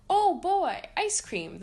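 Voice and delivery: high, loud delighted cry; cried out. Acted. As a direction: burst out in glee at the treat. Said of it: "the friends cried".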